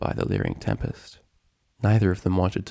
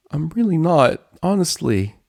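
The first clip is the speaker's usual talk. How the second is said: high, louder, pleading denial; desperate protest; 8 semitones higher, 5 dB louder than usual. Acted. Dragon insisting to his friend he can stay, desperate protest. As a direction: protest it to be believed. Acted protest against leaving.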